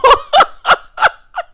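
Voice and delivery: shrieking laugh; high burst of laughter. Real, not acted. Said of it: "laughing at own impression".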